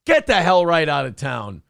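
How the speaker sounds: usual speaking voice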